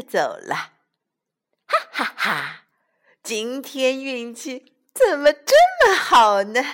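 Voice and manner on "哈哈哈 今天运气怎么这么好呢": loud happy laugh then rising question; gleeful laugh. Acted. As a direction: cackle with delight at your luck. Wolf laughs over his luck.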